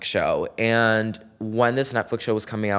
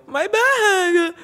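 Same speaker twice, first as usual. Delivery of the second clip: high squealing laugh of relief; delighted scream on getting the bag back; joyful shriek; 6 dB louder than usual. Retold or imitated. Finding the lost bag.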